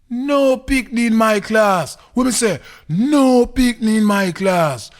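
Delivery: very high, drawn-out boastful cry; loud boasting. Acted. Comic boasts about his class.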